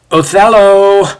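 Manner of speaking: high, loud call to someone ahead or behind; calling back. A narrator's reading. Ezio hailing his lagging companion from twenty feet ahead, calling out. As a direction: call out the name, drawn long and high. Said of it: "Ezio calls back from some twenty feet ahead".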